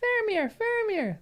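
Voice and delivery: put-on very high, childlike hobbit voice; Pippin's voice. Shown as a demonstration. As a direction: say "Faramir, Faramir" like a small hobbit. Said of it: "That's what he sounds like".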